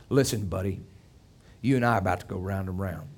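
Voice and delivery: low, softened, quick threat; quiet menace. Retold or imitated. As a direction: imitate a tough man dropping low and quiet to warn someone. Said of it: "he would get real quiet".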